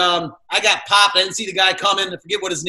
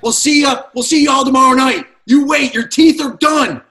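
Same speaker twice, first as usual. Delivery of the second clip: loud, higher taunting shout at the end of a fight; mock rival yelling; 5 semitones higher, 7 dB louder than usual. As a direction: yell it like an angry fan threatening him. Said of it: "and they're like".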